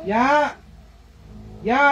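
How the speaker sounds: loud, high sharp ya; sharp call. Real, not acted. Mother calling out sharply at her toddler, loud.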